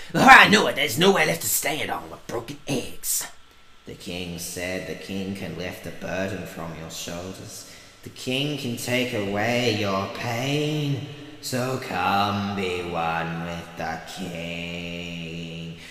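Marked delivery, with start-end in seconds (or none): 3.9-15.8 low, slow, hint of a solemn king; lightly voiced king. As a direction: read it like a quiet solemn king. Reads game text in king's voice.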